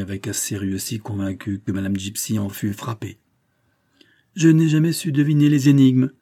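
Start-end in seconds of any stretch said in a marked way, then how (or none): 4.4-6.1 dry retort, louder and higher; a sharp, offended put-down; curt. A narrator's reading. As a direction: answer curtly, voice raised. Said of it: "dit-elle sèchement".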